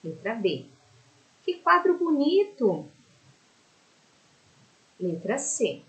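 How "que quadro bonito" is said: higher, louder and delighted on "Que quadro bonito!"; admiring exclamation; exclamation tune. Shown as a demonstration. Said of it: "complete as frases com a pontuação adequada".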